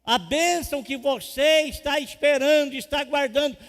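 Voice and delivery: loud, high, climactic sermon shout; full-force preaching. Acted. Pastor promising the blessing is coming, loud climactic preaching. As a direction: drive it home to the crowd.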